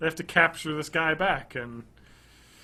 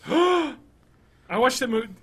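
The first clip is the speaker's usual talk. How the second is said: gasp, then high excited words; 10 semitones higher, 6 dB louder than usual. Real, not acted.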